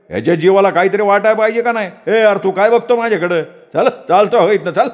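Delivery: high, fast indignant tirade; angry shopkeeper. Acted. Shopkeeper throwing Datta out of the shop, high indignant anger. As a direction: rage at him and order him out.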